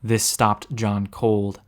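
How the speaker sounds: ordinary delivery, nothing marked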